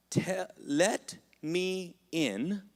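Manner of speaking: very slow drawn-out plea; pleading, slow. Acted. Moses asking God, slow pleading. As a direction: stretch it out as a longing prayer.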